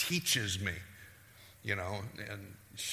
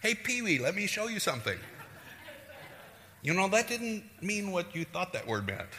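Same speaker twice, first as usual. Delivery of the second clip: higher, chummy mock correction; playful teasing God; 7 semitones higher than usual. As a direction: tease a little pal about their mistake. Playfully voices God teasing a believer.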